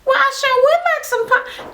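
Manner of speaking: put-on high dainty old-lady voice; prim sweet reply. Guest accepting punch, high dainty voice. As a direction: answer as if delighted and polite. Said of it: "My grandma talk like that".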